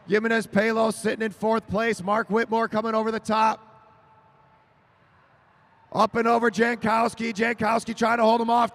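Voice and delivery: energetic announcer play-by-play; lively race call. Real, not acted. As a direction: keep the call moving.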